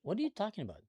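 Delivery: ordinary delivery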